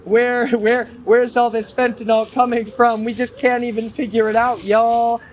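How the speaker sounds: hammed-up innocent puzzlement; mock helplessness. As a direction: play clueless officials wailing in fake dismay. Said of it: "The D E A is like".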